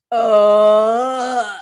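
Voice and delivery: huge loud imitated burp. Elephant burping loudly after laughing. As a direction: let out a big loud burp.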